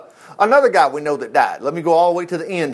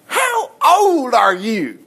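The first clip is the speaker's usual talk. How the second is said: slow, drawn-out royal question; a lightly voiced Pharaoh; Pharaoh asking; 6 semitones higher, much slower than usual. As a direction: ask slowly like a king. Pharaoh asks Jacob's age.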